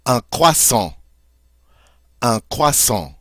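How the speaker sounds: "croissant" as krwah-SAHN with a nasal end; French "Un croissant", not the English kruh-SONT; French pronunciation. Shown as a demonstration. Teacher modelling the French "Un croissant". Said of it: "that's the French word for croissant".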